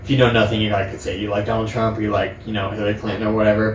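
ordinary talk

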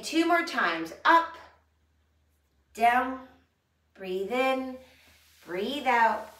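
loud cueing. Shown as a demonstration. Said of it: "coaching the movement".

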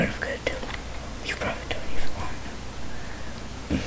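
rushed whispered aside; fast whisper. Acted. Fast whisper to the squid. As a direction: whisper it quickly under breath.

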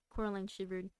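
usual speaking voice

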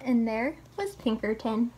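ordinary delivery, nothing marked

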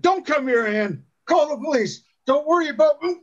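shouted warning. A narrator's reading. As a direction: call out desperately to warn her. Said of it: "Henry shouted".

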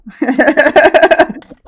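big burst of gleeful laughter; loud triumphant laugh. Real, not acted. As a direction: laugh out loud after the kill. Laughs after a surprise kill. (ضحكة انتصار عالية)

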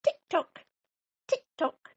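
high, crisp tick-tock; ticking clock. A narrator's reading. Living room clock ticking through the night, high and crisp. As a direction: click like a clock. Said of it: "went the living room clock".

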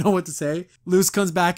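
usual speaking voice

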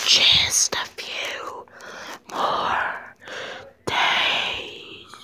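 slow menacing whisper; creepy whisper. Pretend. Evil Selena whispering the dog's fate. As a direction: breathe the threat like a secret.

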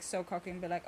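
ordinary talk, nothing marked

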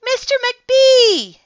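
high, slow call down the hall; long, loud librarian's call. Acted. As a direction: call his name from far away. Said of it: "she called after a minute".

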